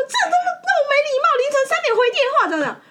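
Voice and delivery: 高八度尖声模仿对方抓狂骂人; 学女生歇斯底里地数落人，边学边笑; mock furious girlfriend. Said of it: "一直跟我讲说". (模仿女友半夜抓狂的尖声责骂)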